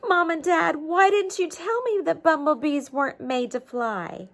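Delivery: a touch of tearful, high pleading; upset child. Acted. Bee child bursting in to ask his parents, lightly tearful. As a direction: cry out to mom and dad, lightly hurt.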